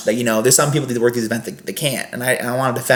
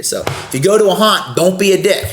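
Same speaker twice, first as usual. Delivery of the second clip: stern warning; 6 semitones higher than usual. Real, not acted. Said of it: "direct warning to listeners".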